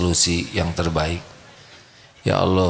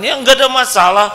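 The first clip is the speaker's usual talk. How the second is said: much higher, louder preaching voice; fiery preaching; 9 dB louder, faster than usual. Acted. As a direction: preach it with full conviction. Preaches at full force. (ceramah berapi-api dengan suara tinggi)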